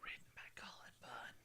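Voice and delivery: soft unvoiced whisper. Real, not acted. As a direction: say it in a hushed whisper. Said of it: "Why are we whispering?".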